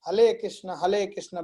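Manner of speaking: "हरे कृष्ण" chanted with R turned to L; lisping child. Retold or imitated. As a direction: imitate a small child's L for R in "हरे". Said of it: "बच्चे बोलते हैं छोटे तोतले वाले".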